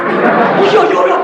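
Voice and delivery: high, loud burst of agreement; acted-out dawning realisation; sudden realisation.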